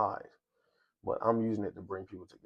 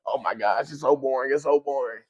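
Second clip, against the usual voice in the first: imitating bored guests, higher and louder; mock bored whine; 4 semitones higher, 6 dB louder than usual. Vlogger mimics bored guests at a long speech event, mock whine. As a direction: groan it like a bored crowd at speeches.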